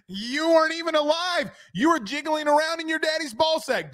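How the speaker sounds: high, incredulous ribbing; high-pitched teasing. Real, not acted. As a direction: tease him, voice pitched well up. Teasing younger co-host about age.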